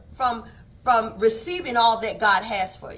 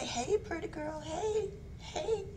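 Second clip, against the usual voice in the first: soft, high-pitched cutesy hello; playful greeting; 8 semitones higher, 11 dB quieter than usual. Retold or imitated. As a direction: imitate greeting a close girlfriend, high and quiet. Re-enacts pet greeting with friend.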